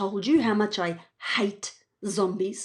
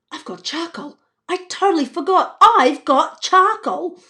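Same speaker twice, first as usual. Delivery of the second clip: excited, higher realisation; sudden realisation; 7 semitones higher, 5 dB louder than usual. Acted. Evie realising to herself, excitedly. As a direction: gasp then get it.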